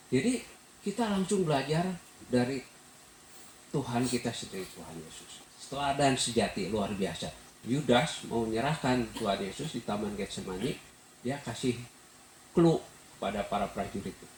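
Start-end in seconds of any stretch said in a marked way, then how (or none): none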